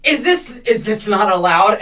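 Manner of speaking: put-on drunk guy's confusion. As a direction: play the clueless drunk guy caught out. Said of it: "And he goes".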